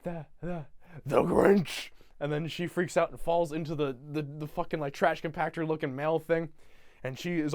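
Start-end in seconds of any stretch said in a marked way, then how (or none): 0.0-1.9 slow, drawn-out menacing mock voice; Grinch. Grinch completing Cindy's sentence, slow hammed-up announcement. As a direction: finish her stammer with a big, slow reveal. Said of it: "and the Grinch is like".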